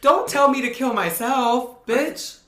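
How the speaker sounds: sharp, high mock clapback; sassy retort. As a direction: fire it back with attitude. Mock quoting the character.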